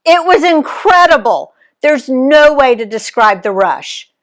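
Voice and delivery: high, loud and breathless with thrill; excited. Acted. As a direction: gush about the rush. Excited after bungee jump.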